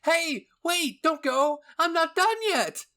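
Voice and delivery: loud, high whine, slowing at the end; whining. A narrator's reading. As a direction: whine like a kid left behind. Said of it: "Hanjie whined".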